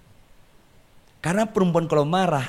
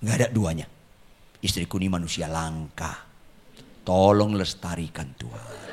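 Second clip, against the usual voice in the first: low, hushed plea to God, getting softer; mock-meek prayer; 8 semitones lower, 6 dB quieter than usual. Husband asking God to preserve his angry wife, hushed. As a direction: pray it privately, deep down and quiet. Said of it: "dia tetap saya bilang".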